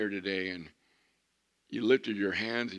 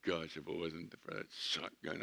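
softer, lower weary groan; glum grumble; 3 semitones lower, 9 dB quieter than usual. Retold or imitated. Unhappy husband complaining about his wife, softer and lower. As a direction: grumble it like a regretful groom. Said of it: "looks at you and goes".

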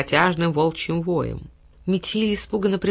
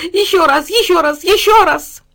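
high, loud, building to the last; ecstatic dog; 12 semitones higher, 9 dB louder than usual. A narrator's reading. Dog kissing the girl again and again, rising ecstasy. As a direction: pile on the kisses.

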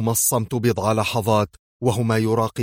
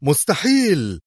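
higher, astonished exclamation; exclaiming; 5 semitones higher, slower than usual. A narrator's reading. Officer staring wide-eyed into the empty silo, exclaiming. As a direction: cry out in disbelief. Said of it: "وهو يهتف".

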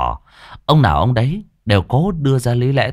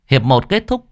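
ordinary delivery

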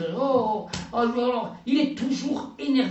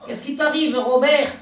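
shouting, much louder and higher; loud villager; 4 semitones higher, 8 dB louder than usual. Acted. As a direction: bawl it across the square. Voiced quarrel between villagers. (cri de villageoise)

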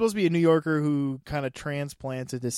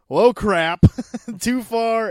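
mock Eddie Brock; 7 semitones higher, 8 dB louder, much slower than usual. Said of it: "Eddie Brock was like".

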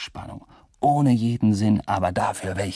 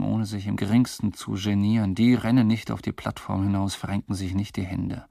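ordinary delivery

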